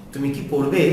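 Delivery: quick short "কি", rising on "পড়বে"; yes-or-no question with unstressed "কি". Shown as a demonstration. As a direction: pass lightly over "কি", lift "পড়বে". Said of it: "ছোট করে দ্রুত উচ্চারণ করতে হবে".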